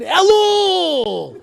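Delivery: Elul! roared with menace; fiery rebbe shout. Retold or imitated. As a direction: thunder Elul! like a fire-and-brimstone rebbe. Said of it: "with flames coming up on the side".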